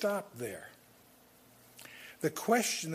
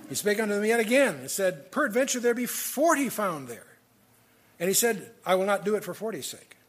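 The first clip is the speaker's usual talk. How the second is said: measured, solemn Bible reading, a touch louder; scripture reading; 4 semitones higher, 6 dB louder than usual. Acted. Preacher reading Genesis to his congregation, solemn scripture reading. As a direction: read the verses out with weight. Reads Genesis aloud in sermon.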